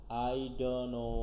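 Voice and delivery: "don't" as "don", slowly running into "know"; T dropped. Shown as a demonstration. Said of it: "ঠ আসতেছে না".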